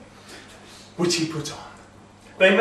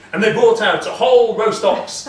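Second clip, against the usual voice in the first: plain reading voice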